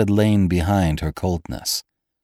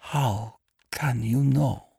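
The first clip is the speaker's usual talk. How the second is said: slow, hushed, high-pitched horror; stunned, softened high voice; horrified near-whisper; 7 semitones higher, 3 dB quieter, slower than usual. A narrator's reading. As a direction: breathe it slowly in sudden horror. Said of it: "she whispered".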